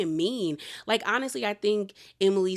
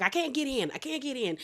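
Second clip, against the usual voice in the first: higher-pitched mock frustration; frustrated; 6 semitones higher than usual. Recapper imitating a friend locked out of the house, frustrated. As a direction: imitate someone locked out and getting overwhelmed, pitched up.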